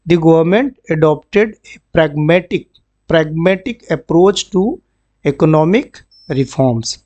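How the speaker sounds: very slow, word-by-word reading; slow classroom read-aloud; slow dictation. Shown as a demonstration. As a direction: spell it out slowly for the class. Teacher reads example sentence.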